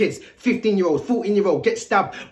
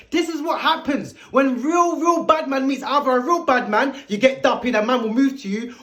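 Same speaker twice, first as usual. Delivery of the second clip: usual speaking voice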